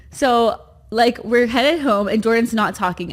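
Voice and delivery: ordinary talk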